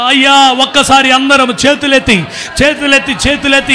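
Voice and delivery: loud, driving preacher's call; full-force preaching. Real, not acted. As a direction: rouse the crowd with a loud call.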